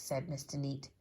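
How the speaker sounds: usual speaking voice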